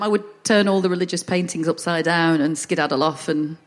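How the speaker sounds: usual speaking voice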